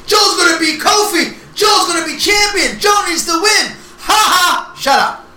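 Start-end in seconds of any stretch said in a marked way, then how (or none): 0.1-3.8 mocking fans. Mocking fans in the crowd with a hammed-up cheer. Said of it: "Everybody who was out there". 4.1-4.7 mock laugh. Mocking laugh after fan quote.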